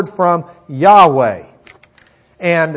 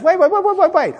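high-pitched string of waits; urgent interruption; faster than usual. Retold or imitated. Jehoshaphat halts Ahab before battle, urgent interruption.

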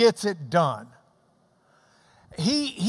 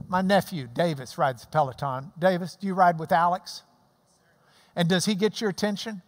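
ordinary talk

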